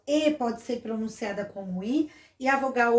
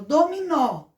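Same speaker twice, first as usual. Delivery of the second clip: dominó with the last syllable punched higher and louder; stress on final NÓ; 3 semitones higher, 6 dB louder, slower than usual. Shown as a demonstration. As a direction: hit the final open ó of dominó. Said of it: "a sílaba forte no nó". (dominó com força na última sílaba, nó)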